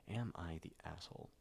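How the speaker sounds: ordinary talk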